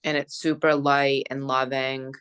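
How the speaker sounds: slow, calm guiding voice with long pauses; guided meditation. Acted. Friend guiding a closing energy visualization, calm meditation voice. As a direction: gently lead them through the visualization.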